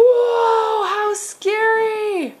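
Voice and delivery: high, slow, hammed-up fright; frightened prey animal. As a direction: gasp at the scary dinosaur, playfully. Parent plays scared of toy dinosaur.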